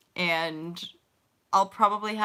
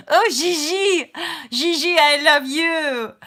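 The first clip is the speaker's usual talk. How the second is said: loud, high, slow gushing cry; excited greeting; 8 semitones higher, 9 dB louder, slower than usual. Real, not acted. Spotting Gigi on camera, loud high gushing cry. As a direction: squeal with love over the dog.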